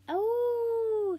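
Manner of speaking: high loud sustained howl; dog howl. Acted. Another dog joins, loud howl. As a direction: howl like a hound. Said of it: "he howled".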